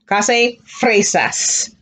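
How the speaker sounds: clase fresas stretched out very slowly; very slow model. Shown as a demonstration. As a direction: draw fresas out for the class. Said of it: "can you get it".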